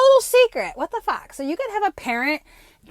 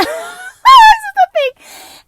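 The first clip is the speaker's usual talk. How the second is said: very high, loud and slow through the laugh; laughing hard; 15 dB louder, much slower than usual. Real, not acted. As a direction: still cracking up as you start talking. Big laugh after psychic parody.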